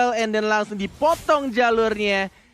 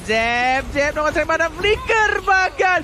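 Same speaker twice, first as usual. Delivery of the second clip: esports caster; 9 semitones higher than usual. Acted. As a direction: push the excitement up. Co-caster hype call. (komentator esports bersemangat)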